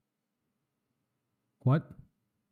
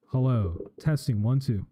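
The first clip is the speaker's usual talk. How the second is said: ordinary talk, nothing marked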